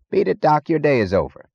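lightly played drunk sending someone off; drunken Meggs, lightly voiced. A narrator's reading. Drunk Meggs to Doctor Stadler, mildly belligerent. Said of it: "Meggs was drunk".

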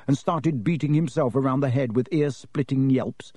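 ordinary delivery

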